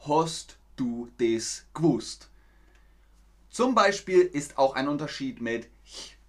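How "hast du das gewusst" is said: "Hast du das gewusst" with Austrian vowels, slow; slow, broad Austrian pronunciation; Austrian accent. Shown as a demonstration. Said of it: "wird dann ausgesprochen".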